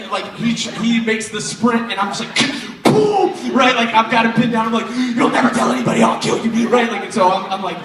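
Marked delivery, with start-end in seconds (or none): 5.2-6.7 loud hammed-up threat; mock threat. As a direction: pin him down and threaten him. Pinning brother down, threatening.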